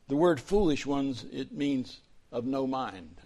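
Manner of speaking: ordinary delivery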